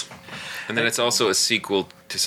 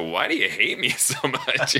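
higher, laughing wounded whine; hurt monk; 6 semitones higher than usual. Retold or imitated. Host quoting the puzzled monk, light laughing whine. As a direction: plead it, half laughing. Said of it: "the monk did not quite get".

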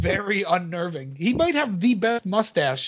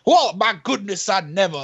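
Steve Harvey. Retold or imitated. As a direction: imitate an affronted southern host. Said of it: "this southern drawl".

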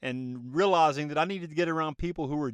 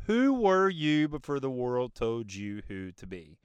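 slower, weighty repeat of the question; 3 semitones lower, slower than usual. Shown as a demonstration. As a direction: let each word sink in. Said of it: "ask yourself that question".

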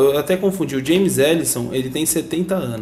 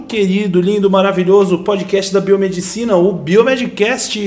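loud, high, hyped show opener; radio announcer; 7 semitones higher, 7 dB louder than usual. Acted. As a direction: announce the show with big energy. Podcast opening announcer.

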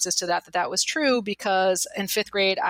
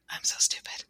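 whispered, defeated; a small whisper to herself; whispered self-doubt; 6 dB quieter than usual. Retold or imitated. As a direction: breathe it out like a secret shame. Self-doubt creeping back.

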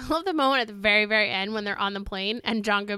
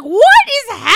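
stunned disbelief; 11 semitones higher, 11 dB louder, much faster than usual. Retold or imitated. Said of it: "and I was like".

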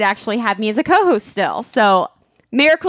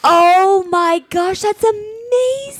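high, slow, squealing oh my gosh; gushing with real delight; freaking out; 8 semitones higher, slower than usual. Real, not acted. Wife thrilled at the podcast ranking, excited squeal. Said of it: "like I'm freaking out".

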